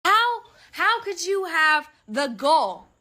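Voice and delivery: ordinary talk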